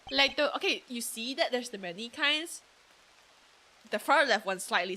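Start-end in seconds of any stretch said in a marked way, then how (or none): none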